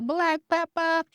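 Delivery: very high, drawn-out catchphrase; imitating Miriam. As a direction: mimic a favorite cook's signature call, slow and high-pitched. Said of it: "but she's always like".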